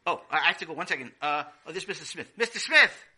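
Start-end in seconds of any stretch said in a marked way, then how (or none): 2.4-3.0 shouting a name across the yard; loud, high call. Acted. Kid calls out to neighbor.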